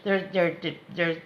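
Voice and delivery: ordinary delivery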